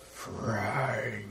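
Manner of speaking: mildly imitating the movie's dull Cenobite call; flat low Cenobite call. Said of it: "instead it's like".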